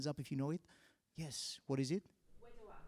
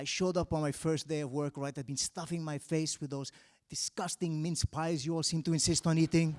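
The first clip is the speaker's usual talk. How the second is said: normal talking voice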